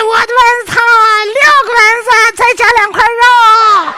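very high, loud falsetto cry; high woman vendor voice. Acted. Woman vendor hawking soup.

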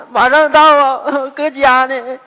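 ordinary talk

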